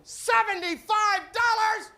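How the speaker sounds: high, loud, slow shout; loudly asking the blonde her price; yelled question. Pretend. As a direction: holler the price out. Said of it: "turning to the beautiful blonde".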